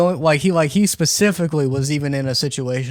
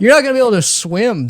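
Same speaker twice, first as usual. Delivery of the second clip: quick, higher-pitched mock rebuke; mock scolding; 6 semitones higher, 4 dB louder, faster than usual. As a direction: scold a foolish kid in jest, fast. Mock rebuke to young fruit eaters.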